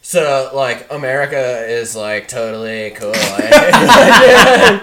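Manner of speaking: seventies surfer guy voice, ending in a laugh; stoked skater drawl. Retold or imitated. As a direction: play a laid-back surfer dude. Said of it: "Starts talking like a skater".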